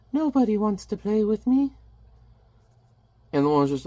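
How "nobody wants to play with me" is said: high, put-on childlike whine; lonely little child. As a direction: play a forlorn child in a high voice. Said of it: "Baby Smith is particularly lonely".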